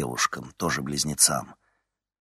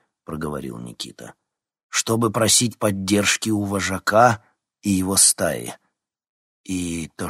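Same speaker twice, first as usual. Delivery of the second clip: loud, solemn ritual formula; formal oath; 4 dB louder than usual. A narrator's reading. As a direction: recite it before the pack. Nikita recites the ritual formally.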